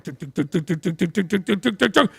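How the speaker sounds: quick soft mouth drum tuk tuk; drum imitation. Shown as a demonstration. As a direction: tap out a drumbeat with your mouth. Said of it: "like, the drums".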